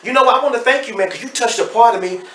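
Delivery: choked-up gratitude spilling out, pitched high; emotional. Real, not acted. Thanking the host, overcome with emotion and rising high. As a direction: voice lifting high with real emotion, half laughing. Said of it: "I'm really emotional".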